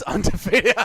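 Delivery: word breaking into laughter; real laugh taking over the word. Real, not acted. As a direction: crack up while saying it. Cracks up at chat message.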